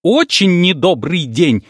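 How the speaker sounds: slow, high angry yell. Acted. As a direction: yell each word out. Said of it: "заорала она".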